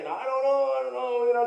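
very high-pitched mock scream; imitated screaming. Woman cop screaming at the man she grabbed, high mock scream. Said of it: "she was screaming so loud".

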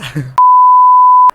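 high loud helpless laugh; exasperated laugh. Real, not acted. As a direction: laugh out of frustration. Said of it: "gülsem mi ağlasam mı". (sinirden yüksek sesle gülme)

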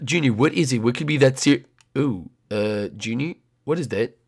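low, soft, drowsy man; low sleepy voice. Acted. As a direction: mumble it half asleep. Gil voiced, low and quiet.

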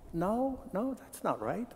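Eve, confused by the serpent, softly; Eve's soft denial. Acted. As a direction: trail off unsure. Eve voiced, thrown off.

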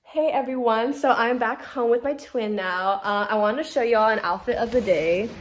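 ordinary talk

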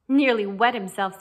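loud contemptuous jab; acted scorn, hit hard. A narrator's reading. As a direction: scoff it out loudly. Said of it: "with a contemptuous glass".

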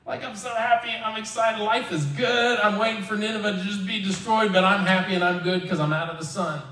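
high-pitched gleeful Jonah; mock giddy happiness. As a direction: play overjoyed, pitched well up. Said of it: "Jonah was very happy about the plant".

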